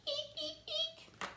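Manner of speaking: very high, soft squeaks; tiny breathy mouse eeks; little mice squeaking quietly. Pretend. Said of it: "Look at all the mice".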